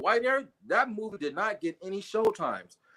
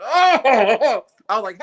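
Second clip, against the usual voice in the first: slow laugh spilling into the words; 5 semitones higher, 11 dB louder, much slower than usual. Real, not acted. Man retelling the theater tease, laughing as he talks. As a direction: laugh through the lead-in.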